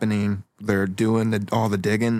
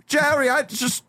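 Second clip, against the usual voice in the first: high-pitched protesting dentist; squeaky, put-upon appeal; pleading dentist; 13 semitones higher than usual. Acted. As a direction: plead your case up high. Dentist pleads in acted quarrel.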